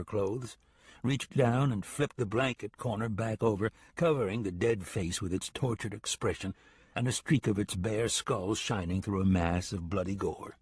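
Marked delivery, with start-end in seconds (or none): none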